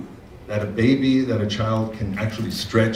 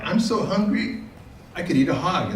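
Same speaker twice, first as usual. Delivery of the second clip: rabbit voice; 7 semitones higher than usual. Acted. Rabbit boasting to the hog.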